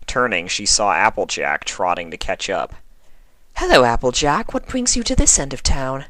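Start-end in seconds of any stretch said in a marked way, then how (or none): 3.6-6.1 high, gracious inquiry; sweet and courteous, a touch of charm; Rarity politely greeting the young constable; polite refined lady. Acted.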